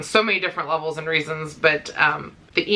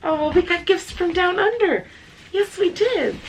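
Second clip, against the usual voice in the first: much higher, singsong to the dogs; pet voice; 10 semitones higher than usual. Real, not acted. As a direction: talk to the dogs like babies. Telling dogs about gifts.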